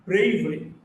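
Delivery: BRA in "Bravery" punched, the ending light; first-syllable stress. Shown as a demonstration. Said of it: "is on the first syllable".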